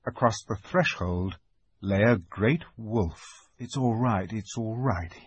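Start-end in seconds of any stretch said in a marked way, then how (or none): 3.6-5.1 muttering to himself. A narrator's reading. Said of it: "he kept saying to himself".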